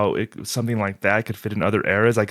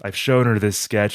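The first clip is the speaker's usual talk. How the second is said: ordinary talk, nothing marked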